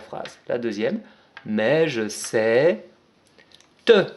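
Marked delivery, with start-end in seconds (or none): 1.5-4.0 drawn out word by word for writing; very slow dictation. Shown as a demonstration. Teacher spelling out the phrase for children, very slow. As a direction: stretch out mais je sais, very slowly. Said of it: "teacher dictating while writing".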